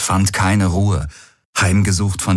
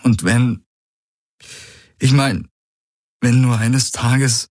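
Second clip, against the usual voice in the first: zitternde Stimme; 6 semitones higher than usual. A narrator's reading. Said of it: "Das Beben ging nun auch in seine Stimme über".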